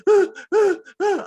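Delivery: high, loud panicked gasping; mock panic gasps. Comic imitating his girlfriend panicking in mock gasps. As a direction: fake a panic attack. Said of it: "having a panic attack".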